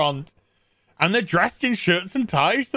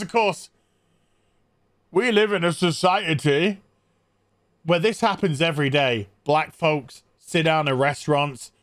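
normal talking voice

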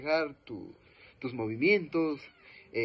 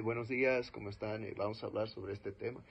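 lower, softer, mumbled greeting; dull, lifeless delivery; 4 semitones lower, 7 dB quieter than usual. Shown as a demonstration. Speaker shows students a bad opening, soft and lifeless. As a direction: sound bored and flat as a bad speaker. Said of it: "suena patético".

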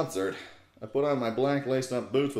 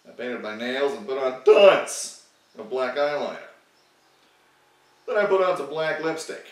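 ordinary delivery, nothing marked

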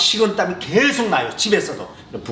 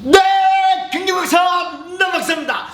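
excited self-announcement. Acted. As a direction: shout the show intro with energy. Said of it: "announcing himself".

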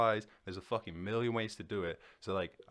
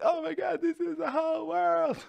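high-pitched mock panic of a stressed teen; panicking teen; 7 dB louder than usual. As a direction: squeal it like the world is ending.

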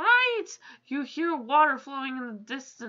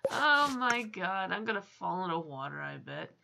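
resigned; 5 semitones lower, 4 dB quieter than usual. Real, not acted. Resigned to falling in the water.

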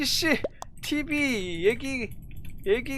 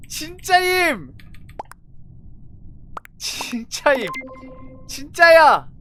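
insistent pleading; 4 semitones higher, 4 dB louder than usual. Real, not acted. Pleading innocence in vote.

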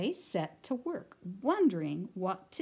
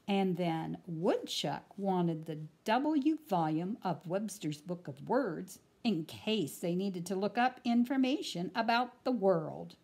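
usual speaking voice

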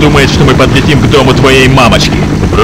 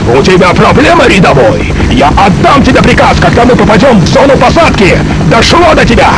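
raised pitch, barking at a subordinate; angry sergeant yell; 7 semitones higher than usual. Acted. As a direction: dress down the private, higher and harder. Sergeant chews out a private.

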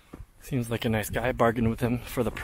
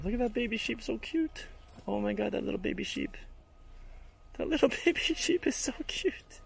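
high squealy coo over something cute; melting over an adorable lamb, voice jumping way up; 11 semitones higher, 9 dB quieter than usual. Real, not acted. Cooing over a baby lamb.

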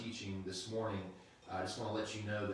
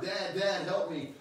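put-on higher, louder pleading kid; child yelling; 9 semitones higher, 7 dB louder, slower than usual. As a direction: call out for dad in a panic. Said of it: "yelling at you".

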